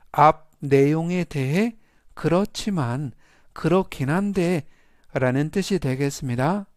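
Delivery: ordinary delivery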